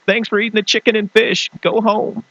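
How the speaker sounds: high hammed-up send-off; mock host dismissal. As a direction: dismiss the guests in a silly voice.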